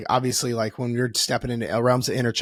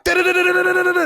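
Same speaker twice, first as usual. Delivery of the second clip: much higher rapid da-da babble; babbling inner child; 3 dB louder, faster than usual. Retold or imitated. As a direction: chatter like an overexcited toddler. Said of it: "it's like".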